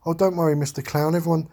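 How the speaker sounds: lightly voiced little girl comforting the clown; mimicking a small girl reassuring someone. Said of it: "And she says".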